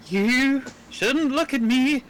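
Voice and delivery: high, loud, slow hag's curse; menacing sea hag. Acted. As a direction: screech a threat like an old witch. Disguised player threatens lookout with curse.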